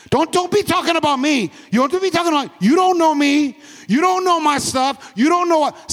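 high-pitched mock indignation, repetitive and insistent; defensive rant. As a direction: act out someone touchy, pitched well up. Acts out defensive somebody's rant.

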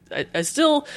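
ordinary delivery

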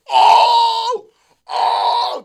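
very high, loud "oh" whoops; drunken whoop. Retold or imitated. Two drunk buddies cheering the bartender's flair, loud high whooping. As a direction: imitate drunk guys roaring at a trick. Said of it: "They're, like, literally doing that".

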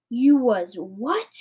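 slow, swooping rise of disbelief; astonished question. A narrator's reading. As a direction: stretch it out, soaring at the end. Giant astonished by Sophie's news.

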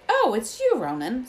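high, loud start, then settling; a burst of relief at seeing a friend; relieved. A narrator's reading. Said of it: "said Hagrid in relief".

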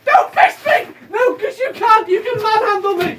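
high, loud laughing protest; laughing yells. Real, not acted. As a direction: shriek in panicked laughter. Playfully grabbed, protesting.

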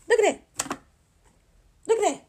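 squealed look at that; mock amazement. Shown as a demonstration. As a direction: pitch it up in mock awe.

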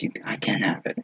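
soft unvoiced whisper of a private refusal; a half-heard whisper from the part that holds back; whispered inner voice. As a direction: breathe it out like a secret thought. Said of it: "a part of you that says".

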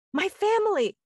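put-on high, loud, wailing child's cry; upset child crying out. Small boy at the park calling to strangers, high tearful wail. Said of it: "the kid was hysterically sobbing".

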